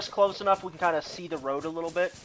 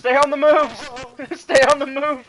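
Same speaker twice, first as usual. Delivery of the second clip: shouting; 5 semitones higher, 7 dB louder than usual. Real, not acted. Fleeing enemies in game.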